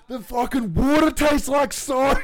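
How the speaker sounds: high, loud, slow hammed-up outrage; mock outraged yell. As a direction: throw a mock tantrum about the water. Retold outburst at Brett.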